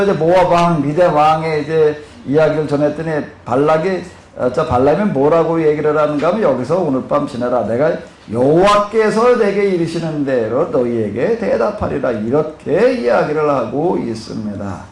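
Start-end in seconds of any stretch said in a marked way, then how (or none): none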